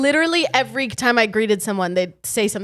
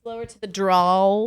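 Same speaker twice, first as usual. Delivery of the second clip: slow mock drawl; 2 semitones lower, slower than usual. Jokey drawling echo.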